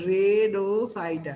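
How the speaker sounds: রেডোফাইটা very slowly, as "re-do-phyta"; altered memory-aid pronunciation. Shown as a demonstration. Tutor drilling students, রেডোফাইটা drawn out as "red-o-phyta". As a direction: stretch out each part of রেডোফাইটা, starting like "red". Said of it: "কি মনে রাখবো?".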